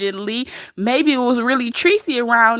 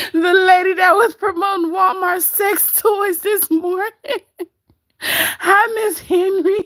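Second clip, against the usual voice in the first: laughing; 8 semitones higher than usual. Real, not acted. Laughing about the sex toy promo.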